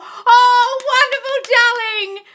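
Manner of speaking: high, loud, drawn-out squeal of delight; delighted cheer. Real, not acted. Coach celebrating a client's daughter smiling at them, delighted cheer. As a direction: whoop it out at full volume.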